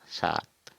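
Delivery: सात with a clear s; simple hissed s, long aa in सात; straightforward Hindi pronunciation. Shown as a demonstration. Teacher contrasting Hindi seven, straightforward s. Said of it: "Hindi में सीधा साधा".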